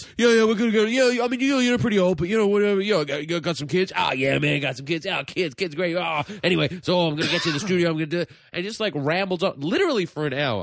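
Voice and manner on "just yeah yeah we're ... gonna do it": high rambling dude voice; bro voice. Retold or imitated. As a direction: rattle on high like a self-important bro. Imitating the rambling producer.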